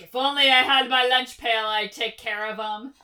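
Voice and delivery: put-on loud, boastful old war veteran; salty old soldier. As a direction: brag like a grizzled old soldier. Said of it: "like the salty old soldier".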